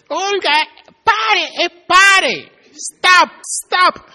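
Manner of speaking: high, loud drawn-out yell of stop; panicked shout. Retold or imitated. Husband in the passenger seat yelling at Olga to stop, high panicked shout. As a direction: yell at her to stop the car, stretching pare.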